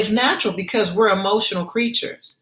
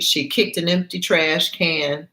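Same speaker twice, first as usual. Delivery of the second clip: ordinary talk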